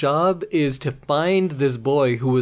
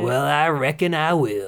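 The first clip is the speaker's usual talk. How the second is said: put-on Southern accent. Agreeing to his cohost in a put-on Southern accent. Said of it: "You should talk like that always".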